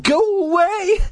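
very high-pitched wail of horror; high, theatrical moan; horrified cry. A narrator's reading. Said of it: "let out a low moan of horror".

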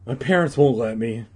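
ordinary delivery

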